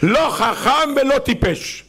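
high, slow, sing-song לא חכם ולא טיפש; mocking request. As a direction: draw it out in a high mocking tune. Hannah's odd request, mocking. (בקשה מלגלגת בקול גבוה ואיטי)

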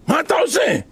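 high-pitched scandalized challenge, acted out; shocked outcry. Priests confronting the man in the wrong garment, shocked outcry. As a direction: cry out in outraged disbelief.

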